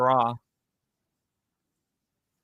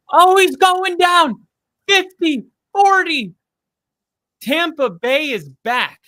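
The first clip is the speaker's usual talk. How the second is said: much higher and louder, real yelling at the play; excited shout; 13 semitones higher, 10 dB louder than usual. Real, not acted. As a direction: yell as the runner breaks free. Big play on the TV.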